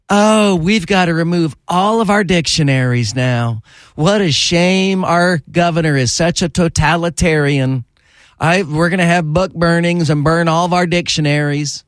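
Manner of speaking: put-on ridiculing book-banning locals in Southern accent; mock Southern accent. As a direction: parody a whiny Southern local. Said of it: "to see if they have Southern accents there".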